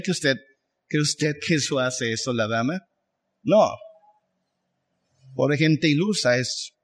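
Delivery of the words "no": ordinary talk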